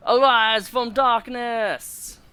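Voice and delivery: high, drawn-out mock Jamaican accent; random Jamaican accent. Gamer playfully commanding Reznov, in a put-on Jamaican accent. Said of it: "I did a randomly Jamaican accent".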